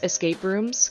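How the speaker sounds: ordinary delivery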